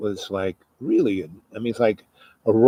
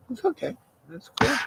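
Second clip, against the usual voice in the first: soft reassurance; 10 semitones higher, 8 dB quieter than usual. Retold or imitated. Teacher reassuring student.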